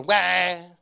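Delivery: loud mock baby cry "guau"; imitated baby wail. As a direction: wail it like a newborn. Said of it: "el otro". (imitando el llanto de un bebé, fuerte)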